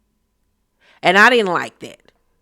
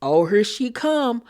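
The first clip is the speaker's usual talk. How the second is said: baiting; 4 dB quieter than usual. Retold or imitated. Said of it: "really baiting her".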